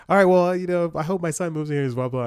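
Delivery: breezy imitation of a Jersey mom wrapping up; New Jersey mom. Mom saying goodbye after the chat, imitated New Jersey mom. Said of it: "straight out of New Jersey mom".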